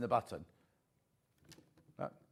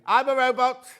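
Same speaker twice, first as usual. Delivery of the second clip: robot shout; 7 semitones higher, 9 dB louder than usual. Retold or imitated. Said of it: "Man with giant thimbles shouting".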